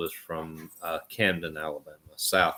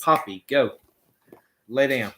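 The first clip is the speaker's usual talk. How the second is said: higher, raised command voice; firm dog command; 5 semitones higher, 5 dB louder than usual. Real, not acted. Owner ordering Poppy to lie down, firm command.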